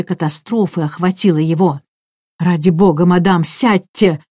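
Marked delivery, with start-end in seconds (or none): none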